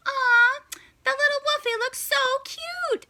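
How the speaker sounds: sweet gushing coo. Acted. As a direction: aww at a sleeping puppy.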